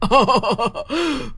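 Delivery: gleeful witchy laugh; witch's cackle. Acted.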